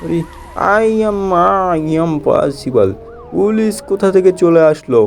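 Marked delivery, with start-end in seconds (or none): 0.0-2.9 Chingam catchphrase twist. Acted. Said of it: "impossible".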